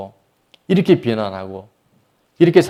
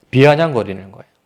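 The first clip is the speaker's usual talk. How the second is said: ordinary talk, nothing marked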